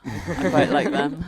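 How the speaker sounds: slow British accent, amused; sixties British. Shown as a demonstration. Woman showing her British to friends, slow sixties British accent. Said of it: "it's like a sixties British accent".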